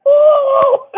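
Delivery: high, loud drawn-out "oh"; mock exclamation. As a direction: let out a hammed-up high whoop. Imitates own exclamation while sitting there.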